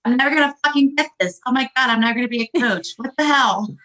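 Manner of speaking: fast, loud, high frustrated rant; frustrated outburst. Retold or imitated. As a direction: rattle it off in exasperation. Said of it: "I was so frustrated".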